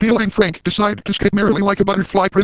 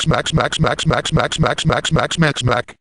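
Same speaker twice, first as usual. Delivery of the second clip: mouthed smacking noise; 3 semitones lower, much slower than usual. Acted. Imitated slapping noise.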